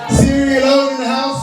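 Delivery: high, slow shout to the crowd; crowd call-out. Real, not acted. Host shouting out to the Sierra Leone fans, high and slow. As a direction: hype the room, drawn out.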